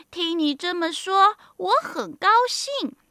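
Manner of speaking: high-voiced, satisfied little old woman; pleased old mother. Old mother pleased with giant son.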